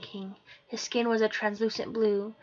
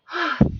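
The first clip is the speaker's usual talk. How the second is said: relieved sigh; 8 semitones higher, 9 dB louder than usual. Acted. Allie sighs in relief.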